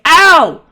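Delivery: high, sudden cry of pain; loud yelp. Acted. Climber interrupted at the wall, loud high yelp. Game master voices Sammy mid-action.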